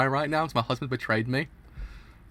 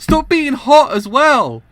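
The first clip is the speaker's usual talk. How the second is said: loud, slow, high wail; loud slow protest at the screen; anguished yell; 13 semitones higher, 13 dB louder, much slower than usual. Real, not acted. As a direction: drag it out in mock anguish.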